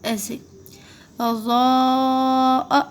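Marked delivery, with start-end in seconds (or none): 1.2-2.8 اضاء with a very long level "zoo" cut off at the end; elongated madd. Shown as a demonstration. Teacher stretching Zaad for learners, elongated. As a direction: hold the middle vowel of اضاء on one note. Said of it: "زاد کو بھی اسی طرح کھینچیں گے".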